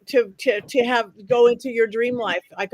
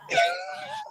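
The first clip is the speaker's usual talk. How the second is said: shrieking laugh; 5 dB quieter than usual. Real, not acted. Reaction to Locust Valley.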